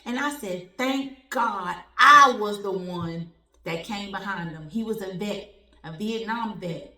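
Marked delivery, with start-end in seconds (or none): 0.8-4.7 loud, slow, emphatic exclaim; loud slow thankful cry. Retold or imitated. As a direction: proclaim it with relief. Retold relief at the bank.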